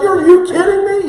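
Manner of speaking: high, loud, strained yelp; panicked disbelief. Retold or imitated. Boy panicking at his aunt on the ride, high shriek. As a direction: shriek it in terror. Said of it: "the panic starts setting in".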